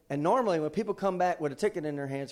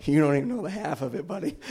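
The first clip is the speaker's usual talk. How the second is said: wry retort; 8 dB louder than usual. Retold or imitated. Inner retort, louder.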